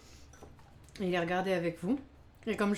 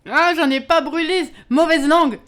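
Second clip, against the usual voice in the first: high, drawn-out, playful outcry; loud indignant protest; 8 semitones higher, 13 dB louder than usual. Real, not acted. Streamer answering viewers' teasing about burnt cakes, loud indignant protest. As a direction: shout back at the teasing, much louder.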